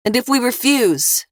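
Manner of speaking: defiant shout. A narrator's reading. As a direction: shout back at the rooftop. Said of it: "Eva shouted".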